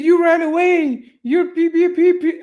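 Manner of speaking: hammed-up shrill mock of an emotional guy; mocking whiny high voice. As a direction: mimic him in a squeaky, childish whine. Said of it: "He's like".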